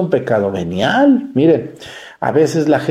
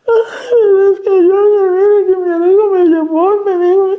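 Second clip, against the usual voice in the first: much higher, louder hammed-up wailing sob; mock sobbing woman; 5 dB louder than usual. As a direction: imitate a weeping parishioner who can't stop crying. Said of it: "Señora, llore lo que guste".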